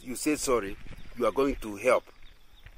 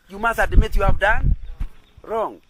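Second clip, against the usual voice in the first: usual speaking voice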